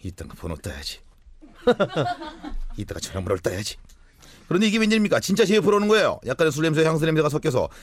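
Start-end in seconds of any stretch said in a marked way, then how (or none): 0.0-3.8 low, hushed thinking aloud; inner voice. Retold or imitated. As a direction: mutter it to yourself under breath. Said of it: "저는 속으로 말했죠".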